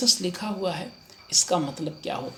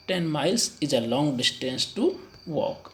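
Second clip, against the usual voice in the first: slow model sentence; much slower than usual. Shown as a demonstration. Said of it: "correct answer is B".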